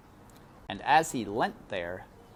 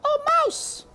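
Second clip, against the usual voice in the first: high, loud cry of surprise; cook surprised; 8 dB louder than usual. A narrator's reading. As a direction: gasp out at the mouse. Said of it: "said Cook".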